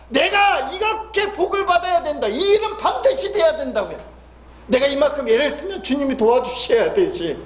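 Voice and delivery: imitating a demanding believer, high, half shouting; mock a whining prayer that insists on its own way; stubborn believer's prayer. (고집 센 신자가 주님께 떼쓰듯 높게 외치는 흉내)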